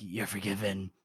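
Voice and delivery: low quiet menace. Retold or imitated. The Bloody Nine answers a surrendering soldier, low and menacing. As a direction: let it drop low and quiet, like a threat. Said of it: "the Bloody Nine is like".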